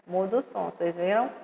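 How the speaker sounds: ordinary talk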